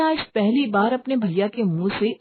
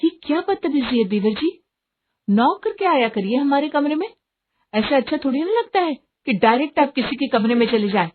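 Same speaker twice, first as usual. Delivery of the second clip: higher-pitched, affronted telling-off; mildly outraged, raised-pitch reproach; indignant scolding; 6 semitones higher than usual. Acted. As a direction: scold the intruding brother-in-law. Sister-in-law scolds him for barging in.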